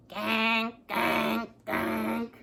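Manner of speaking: big duck calls. Retold or imitated. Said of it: "The big ducks say".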